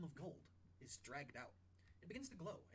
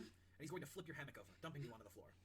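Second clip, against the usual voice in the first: ordinary talk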